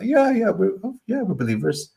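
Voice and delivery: high, breezy put-on voice of a fake friend; fake casual hypocrite. Hypocrite greeting the believers with put-on breeziness. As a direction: play it cool, trying too hard to fit in. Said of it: "You know, like the meme".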